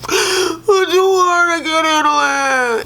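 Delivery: hammed-up tearful whine; mock crying Darcy. Mimicking wife in confessional, high hammed-up sobbing. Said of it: "she's like".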